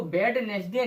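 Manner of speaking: "वेडनेसडे" with the first D sounded, bed-nes-day; wrong pronunciation. Shown as a demonstration. Teacher showing what not to read, wrong pronunciation. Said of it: "नहीं पढ़ेंगे".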